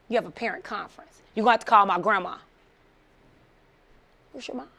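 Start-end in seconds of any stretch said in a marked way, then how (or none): 4.3-4.7 quiet aside, as if to oneself; inner thought. Retold or imitated. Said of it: "I'm thinking in my head".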